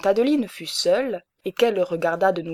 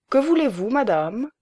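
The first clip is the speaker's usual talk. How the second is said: higher, gallant and courteous; a gentleman bowing to a lady, higher; 5 semitones higher than usual. A narrator's reading. Baron greeting a customer gallantly, higher pitch. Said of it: "dit Hulot galamment".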